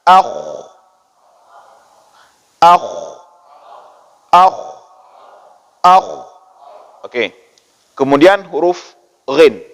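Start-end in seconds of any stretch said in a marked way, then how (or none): 0.1-6.1 akh ending in a rough throat scrape; sukun akh drill. Shown as a demonstration. Teacher showing kha with sukun, akh ends in a throaty scrape. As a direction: close each akh with a raspy stop at the back of the throat. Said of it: "Kita sukunkan".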